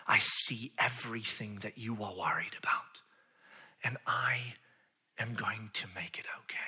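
low, whispery reassurance; hushed promise, trailing very soft; tender compassion. Acted. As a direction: comfort her gently, phrase by phrase. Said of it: "He stops and he sees her".

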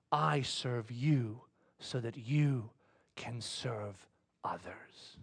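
slow, low, very soft pronouncement; hushed, weighty reply; solemn hush. Retold or imitated. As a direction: let each phrase sink in gently. Jesus' solemn answer, retold.

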